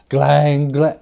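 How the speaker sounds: a final loud double clang; loud bell sound. Acted. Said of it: "so did every bell in the house".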